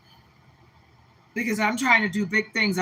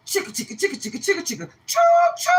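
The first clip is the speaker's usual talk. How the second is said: train noise; 10 semitones higher, much faster than usual. Acted. Playful train noise for faith.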